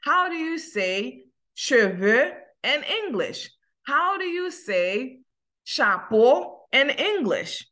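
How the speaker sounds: slow, deliberate teacher model; slow model question. Shown as a demonstration. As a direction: spell out each question for the class. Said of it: "ou di".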